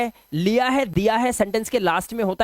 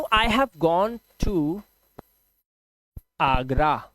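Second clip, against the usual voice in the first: slow demo; 3 semitones lower, much slower than usual. Shown as a demonstration. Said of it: "ध्यान से देखो".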